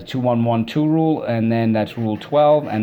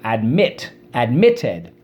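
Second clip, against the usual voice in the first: "Admit. Admitted." with MIT punched louder; stress on second syllable; 4 dB louder, much slower than usual. Shown as a demonstration. Teacher showing where the accent falls, stress on second syllable. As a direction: hit MIT hard in both "Admit" and "Admitted". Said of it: "the accent is on the second syllable".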